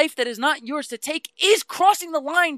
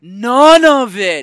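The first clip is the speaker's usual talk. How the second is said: slow, loud drawn-out none; emphatic; 12 dB louder, much slower than usual. Real, not acted. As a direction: drag out NONE heavily. Agreeing emphatically.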